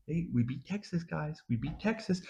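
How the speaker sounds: very soft, small-voiced reminder; meek consolation. Real, not acted. Fan consoling fellow fans with a past win, very quiet. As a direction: offer it very quietly, almost under your breath.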